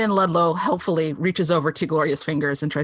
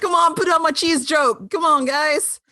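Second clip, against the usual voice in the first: mock writer; 7 semitones higher than usual. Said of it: "One writer was like".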